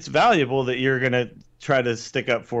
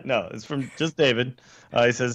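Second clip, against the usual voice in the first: ordinary talk, nothing marked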